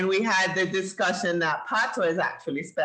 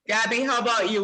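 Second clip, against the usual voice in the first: ordinary talk, nothing marked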